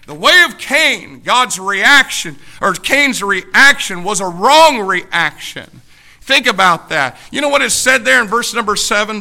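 loud, slowing preacher's cry; full-force preaching. Real, not acted. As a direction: drive it home from the pulpit.

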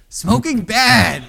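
put-on high, loud and slow childlike blurt, laughing; laughing, drawn-out kid shout of "Smoking bad.". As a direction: blurt "Smoking bad." slowly and loudly at the smoker. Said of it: "what do I say?".

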